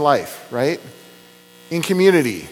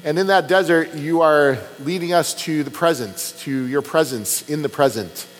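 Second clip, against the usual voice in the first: lower, earnest prayer voice; closing prayer; 5 semitones lower than usual. Acted. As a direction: pastor praying to God to close the sermon. Pastor leads closing prayer.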